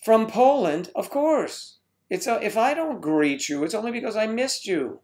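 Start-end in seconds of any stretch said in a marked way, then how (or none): none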